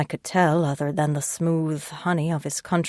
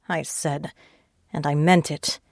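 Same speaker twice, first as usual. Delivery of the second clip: usual speaking voice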